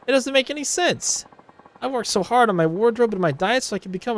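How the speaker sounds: high, rising indignant outburst; can't believe she lost; hammed-up indignant rival idol; outraged sore loser. Ulala outraged at losing.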